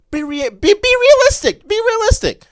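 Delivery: loud exasperated shout. Real, not acted. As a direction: yell it in disbelief. Exasperated rant at Lakers fans.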